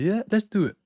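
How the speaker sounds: high-pitched, badly faked American accent; bad North American accent. Companies agreeing to run their ads, bad American accent. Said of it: "the worst possible accent".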